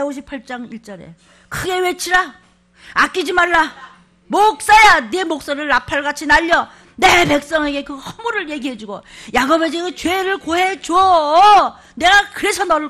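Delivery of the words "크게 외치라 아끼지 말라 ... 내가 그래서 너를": loud, commanding proclamation; booming voice of God. Acted. Pastor booming God's command to the prophet, loud. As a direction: thunder it like God calling out.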